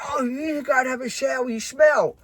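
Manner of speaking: high voice, pushing him away; high, grossed-out order to go shower; Georgia disgusted. Retold or imitated. Georgia sending Carl to the shower, high disgusted. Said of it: "George says".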